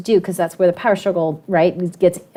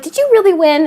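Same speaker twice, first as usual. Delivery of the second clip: put-on high, eager voice of a kid who can't believe it; amazed student; 8 semitones higher, 4 dB louder than usual. Students asking their teacher about the award, amazed and high. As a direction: sound like a kid hearing the teacher won. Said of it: "they're like".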